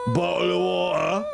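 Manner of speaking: exaggerated British accent, dropping the t's. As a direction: show off a posh-ish British accent. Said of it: "It's very British".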